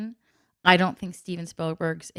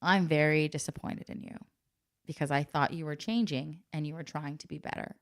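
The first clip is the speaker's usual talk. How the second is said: very calm disappointment; 2 semitones lower, 9 dB quieter than usual. Retold or imitated. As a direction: hit hard by speaking quietly and calmly. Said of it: "I was very calm".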